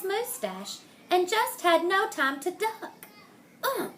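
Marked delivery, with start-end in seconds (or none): 3.6-3.9 a louder, lightly voiced startled apology; mild mock bump and polite pardon. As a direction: blurt it out as they collide. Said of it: "they both said as they bumped".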